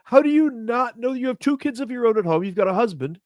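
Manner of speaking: high-pitched, exasperated disbelief; baffled, indignant rant at an absent culprit; incredulous scolding. Acted. Scolding the woman in the story.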